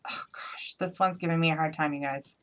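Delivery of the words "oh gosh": breathy whispered groan to herself; whispered aside. Real, not acted. Crafter fighting her bow, whispering to herself. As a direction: whisper it under your breath.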